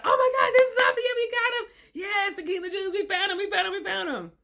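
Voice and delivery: high, squealing mock excitement; acted overjoyed excitement. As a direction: play the thrilled wise men, voice way up high. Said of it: "They're excited, you all. They're really excited".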